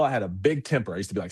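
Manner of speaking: ordinary delivery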